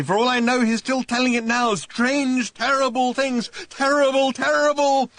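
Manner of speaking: high, loud screaming. A narrator's reading. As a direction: scream it in horror. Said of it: "he screamed".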